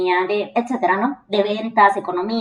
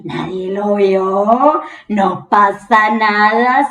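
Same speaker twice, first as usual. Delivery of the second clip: self-reassurance; 2 semitones lower, 3 dB louder, much slower than usual. Retold or imitated. Reassuring herself after blunder.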